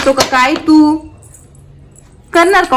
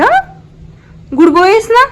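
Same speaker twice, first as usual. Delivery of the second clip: coaxing praise; 11 semitones higher, 4 dB louder than usual. Real, not acted. Praising and coaxing her child.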